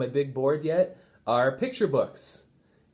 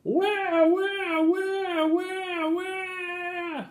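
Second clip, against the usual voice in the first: put-on very high baby wail of wah, fading; high wah-wah bawling; screaming baby; much slower than usual. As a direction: scream like an infant. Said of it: "It screamed really loudly".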